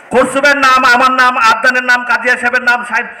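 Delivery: ordinary talk, nothing marked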